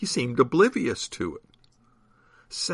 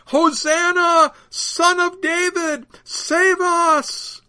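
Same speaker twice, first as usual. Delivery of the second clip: high, loud, drawn-out shout; crowd's cry; 6 dB louder, much slower than usual. A narrator's reading. Pilgrims hailing the king in procession, loud crying out. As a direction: cry out slowly over a procession. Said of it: "a call went up".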